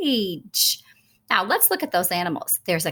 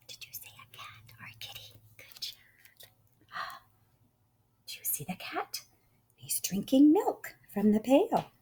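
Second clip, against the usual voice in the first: very soft whisper to little listeners; hushed, whispery praise and questions; hushed encouragement; 3 semitones higher, 10 dB quieter than usual. Real, not acted. Softly coaxing the listening children.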